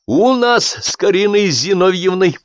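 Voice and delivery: high, slow, pleased clarification; with pleasure. A narrator's reading. Gerasim proudly clarifying to Zolotar, pleased. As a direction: savor the news. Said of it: "с удовольствием уточнил".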